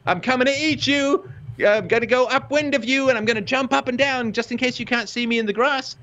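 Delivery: honest lion. Retold or imitated. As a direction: sing out high like a lion that hides nothing. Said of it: "the lion that says".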